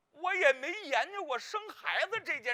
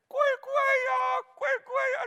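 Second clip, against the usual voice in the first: mock wailing wife; 12 semitones higher, 5 dB louder than usual. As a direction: wail like a sobbing mother, hammed up. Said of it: "当时我那弟妹哭的哟，".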